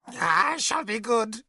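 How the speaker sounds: a blinded wizard begging; broken old Merlin. Acted. Blind mad Merlin pleads.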